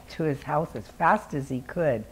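usual speaking voice

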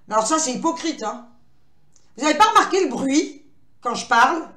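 ordinary talk, nothing marked

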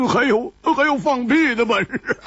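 words breaking into laughter; can't hold back the laugh; laughing tease. A narrator's reading. Hu Tiehua mocking Chu Liuxiang's boasting, laughing.

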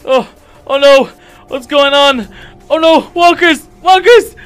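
high, loud, frantic yelling; frantic shouts to captors; panicked shouting. Acted. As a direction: yell in terror, trapped and grabbed. Captured, panicking among walkers.

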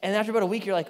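ordinary delivery

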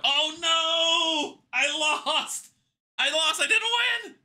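high, loud cry of dismay. Real, not acted. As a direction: wail at losing the roll. Losing the final dice roll.

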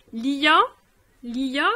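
lia said clearly with the ya ending; model word. Shown as a demonstration. Teacher modelling lia to a student, clear. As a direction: sound out lia. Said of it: "la préposition li".

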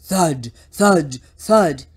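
loud, weighty footstep words; booming storybook sound effect; heavy thuds. A narrator's reading. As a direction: drop each thud like a heavy foot. Voicing the monster's heavy footsteps.

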